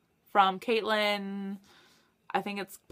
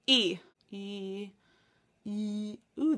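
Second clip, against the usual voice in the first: ordinary talk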